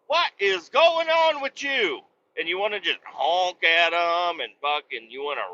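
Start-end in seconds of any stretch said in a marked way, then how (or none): 0.1-2.0 high, slow, baffled question; exasperated disbelief. Retold or imitated. Trucker baffled at the slowing car, high and drawn out. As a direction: stretch it out in disbelief. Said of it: "And you're like".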